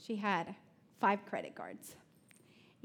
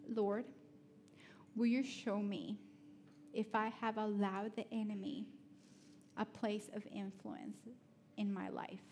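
prayer; 6 dB quieter than usual. Acted. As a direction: pray it gently aloud. Leads a prayer.

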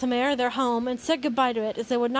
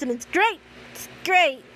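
higher, strained insistence that all is great; forced enthusiasm; 4 semitones higher than usual. Real, not acted. Reader bothered by the pairing in the story, forced enthusiasm. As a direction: talk yourself into it, pitch pushed up.

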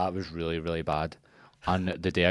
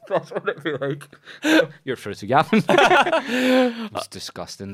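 laughing; 13 semitones higher, 9 dB louder, slower than usual. Pretend. Mock call-centre greeting, laughing.

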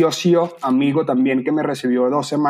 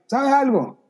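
mock excited shouting; 9 semitones higher than usual. Retelling shouting advice at protesters.

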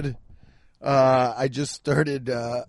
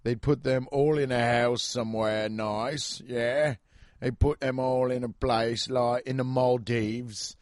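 put-on British accent. Explaining a British dating show's premise, in a mock British accent. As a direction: describe it as a British person would. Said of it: "it was British people".

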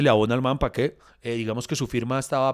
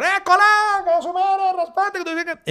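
high-pitched imitation of people yelling from a queue; mock angry crowd; 4 dB louder than usual. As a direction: heckle like an outraged queue, pitched way up. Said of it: "la gente iba a empezar". (imitación aguda de gente reclamando a gritos)

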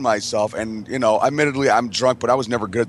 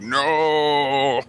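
mock alarmed no; 4 semitones higher than usual. Reacting as Melville jumps on him.

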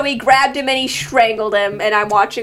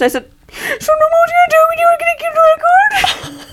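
crying shout; 4 dB louder than usual. Retold or imitated. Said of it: "I cried so hard".

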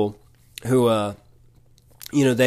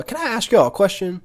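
put-on higher-pitched Mississippi accent; Keith Moore impression; 8 semitones higher than usual. Pastor asking the couple a question, in a Mississippi accent. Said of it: "has a Mississippi accent".

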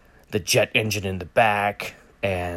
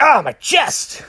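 much higher, louder, slow hammed-up yelp; mock pain; 5 dB louder, slower than usual. Batman shot by police, hammed-up high yelp. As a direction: ham up being shot. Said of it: "he's like".

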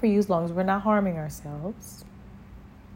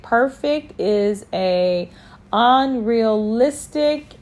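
slow, louder preaching delivery; 4 dB louder, slower than usual. Acted. Preacher telling listeners it is okay to fail, preaching slowly and forcefully. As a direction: drive each phrase home like a pulpit sermon.

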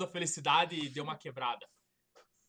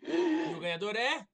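hyped giveaway announcement; 4 semitones higher, 5 dB louder, slower than usual. Acted.

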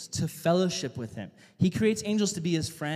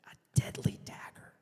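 whispered melodramatic end; dramatic whisper; 7 dB quieter than usual. Retold or imitated. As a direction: breathe it like a dying teen.

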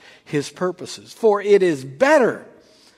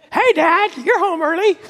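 high, cheerful pet voice; dog's voice; 9 semitones higher than usual. Pretend. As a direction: voice the dog greeting his owner. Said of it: "That's how he talks".

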